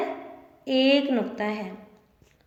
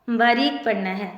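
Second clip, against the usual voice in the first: normal talking voice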